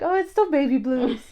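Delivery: high, put-on dismissive voice; mock denial. Mother quoting her past self, mock denial.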